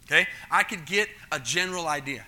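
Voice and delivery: usual speaking voice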